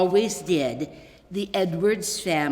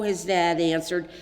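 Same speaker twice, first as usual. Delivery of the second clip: ordinary delivery, nothing marked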